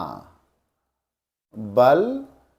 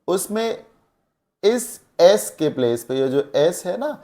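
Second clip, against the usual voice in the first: normal talking voice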